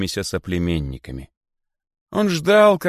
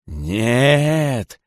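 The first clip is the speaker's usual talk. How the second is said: loud, bitter cry; bitter exclamation; 5 semitones higher, 6 dB louder than usual. A narrator's reading. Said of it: "с величайшей горечью воскликнул царь". (с величайшей горечью)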